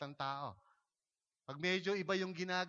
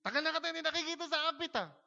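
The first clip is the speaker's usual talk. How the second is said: mock cheery pastor; 9 semitones higher, faster than usual. Pastor greets quiet member.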